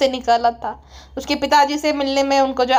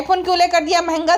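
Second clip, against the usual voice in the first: ordinary talk, nothing marked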